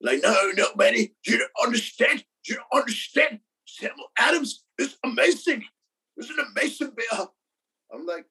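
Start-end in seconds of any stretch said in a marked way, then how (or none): 0.2-7.3 high-pitched, insistent mock Irish accent. Host as his Irish roommate defending his favourite beer, Irish accent. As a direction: plead the case in a rough Irish accent. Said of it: "So that's the Irish accent?".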